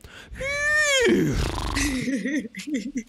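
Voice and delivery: long high whinny with laughter; second horse neigh. Pretend. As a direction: neigh again, laughing through it. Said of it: "let me try one more time".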